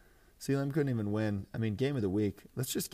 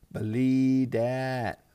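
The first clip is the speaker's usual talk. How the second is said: stretched way out, loud and higher; loud drawn-out boast; 5 semitones higher, 8 dB louder, much slower than usual. Real, not acted. Fantasy player bragging about his trades, loud drawn-out boast. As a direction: crow it slowly, holding each word.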